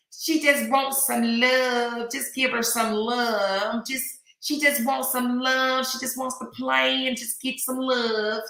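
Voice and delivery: a daughter-in-law's country drawl, mimicked slow and high; imitating country twang. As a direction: drawl it like a country girl from North Carolina. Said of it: "That's how she talk".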